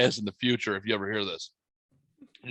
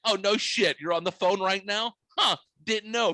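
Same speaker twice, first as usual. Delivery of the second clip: mock-surprised, higher sarcastic quip; sarcastic smartass; 8 semitones higher than usual. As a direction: needle her with fake surprise. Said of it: "I'm just being a smartass".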